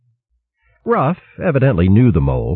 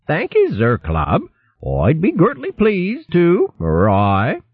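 high hare in mole burr; west country accent; 11 semitones higher than usual. A narrator's reading. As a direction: put on the broad mole dialect. Dotty in mole dialect.

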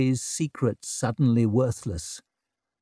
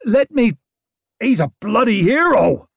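rowdy shout; 9 semitones higher, 6 dB louder than usual. A narrator's reading. Crowd member shouting.

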